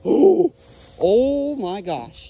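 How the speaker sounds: sudden high oh, then slow my gosh; surprised gasp. Real, not acted. Magnet fisher spotting a knife, stunned and drawn out. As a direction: caught off guard by the find.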